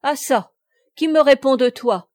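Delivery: put-on a captain calls out to the cheeky boy, loud and high; loud challenge. A narrator's reading.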